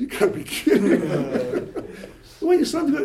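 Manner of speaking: incredulous, breaking into laughter; laughing disbelief. Retold or imitated. Rabbi retelling his reply to the mother, laughing in disbelief. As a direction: laugh through the retold reply.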